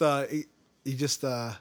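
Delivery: ordinary talk, nothing marked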